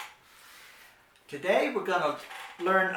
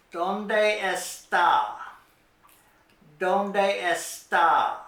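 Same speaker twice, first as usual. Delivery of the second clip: "¿Dónde está?" said slowly and louder, every syllable clear; slow clear model; 4 dB louder, much slower than usual. Shown as a demonstration. Teacher slowly modelling ¿Dónde está? for children. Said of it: "a very handy phrase to know".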